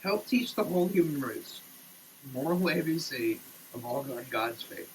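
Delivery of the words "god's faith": ordinary talk, nothing marked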